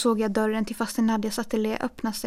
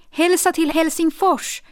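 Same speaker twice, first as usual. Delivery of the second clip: loud, higher call down the street; extra loud shout; 6 semitones higher, 6 dB louder than usual. Acted. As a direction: shout it so she hears from the gate. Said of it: "skrek jag extra högt". (skriker extra högt)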